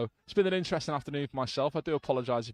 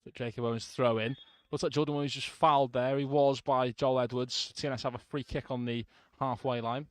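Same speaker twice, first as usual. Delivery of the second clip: live football commentary; play-by-play commentary. Real, not acted. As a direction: call the match as it happens.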